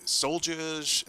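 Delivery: soldiers said the Boston way, as "solljahs", with no r; a slip into a Boston accent on soldiers. Real, not acted. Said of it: "that was my Boston accent coming out there".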